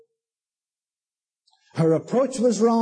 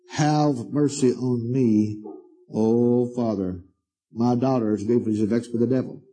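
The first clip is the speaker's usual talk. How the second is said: mock pleading; 7 semitones lower than usual. Preacher mocking the woman's selfish plea, low and whiny. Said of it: "That's a very selfish, uncouth statement".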